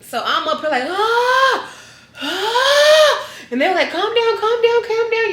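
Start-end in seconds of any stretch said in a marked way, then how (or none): 0.8-3.3 high pained mock screams, hammed up; retold scream. Post-op patient screaming in recovery room, high hammed-up scream. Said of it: "I started screaming". 3.9-5.3 higher, laughing imitation of hurried nurses; mock nurse calming. Nurses calming a screaming patient, high laughing mock hush.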